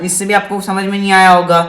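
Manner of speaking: ordinary talk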